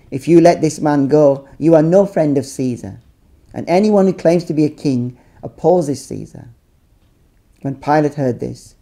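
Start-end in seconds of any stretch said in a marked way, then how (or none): none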